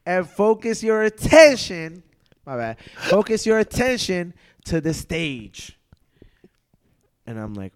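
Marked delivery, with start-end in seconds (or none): 0.1-2.0 loud, high, imitating an emcee; stage announcement. Acting her announcement from the stage. 3.0-5.8 imitated emcee, louder and higher; stage announcement. Restarts her announcement to the room.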